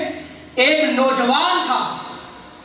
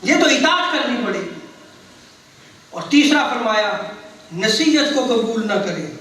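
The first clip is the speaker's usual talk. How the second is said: ordinary delivery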